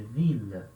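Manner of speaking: "vila" as French veel with a clear L; stronger L. Shown as a demonstration. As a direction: sound the L firmly in "vila". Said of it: "o som do L mais forte".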